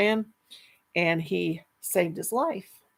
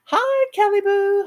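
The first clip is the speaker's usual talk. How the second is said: high greeting; 14 semitones higher, 6 dB louder than usual. Real, not acted.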